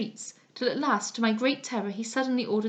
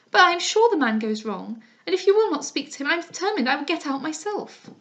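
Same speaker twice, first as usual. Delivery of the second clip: louder, higher, fast and frantic; frightened insistence; 6 semitones higher, 5 dB louder than usual. Acted. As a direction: push out the words in panic. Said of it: "quite out of breath".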